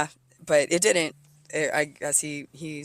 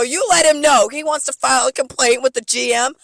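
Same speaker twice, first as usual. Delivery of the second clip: high, loud furious rant; yelling threats at the bar manager; 6 semitones higher, 6 dB louder than usual. Retold or imitated. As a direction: yell so the whole kitchen hears. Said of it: "I'm yelling. I'm mad".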